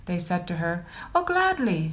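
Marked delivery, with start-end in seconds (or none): 1.1-1.9 bright eager reply. Acted. Suzanne gladly offering to judge the brothers, pitched high. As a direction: lift it high and glad. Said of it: "she answered".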